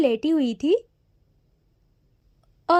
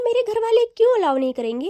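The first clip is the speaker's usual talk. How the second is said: higher, fast, offended retort; indignant; 6 semitones higher, faster than usual. Acted. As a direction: fire it back, taken aback. (हैरान और नाराज़ होकर, तेज़ी से)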